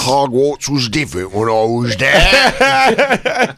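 put-on slow old wizard reminiscing. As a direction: drawl it like a gruff old wizard.

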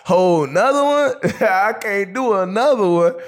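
high-pitched, laughing rookie disbelief; a rookie laughing in shock at another season. Retold or imitated. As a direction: squeal it out through laughter, loud and high. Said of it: "I'm like".

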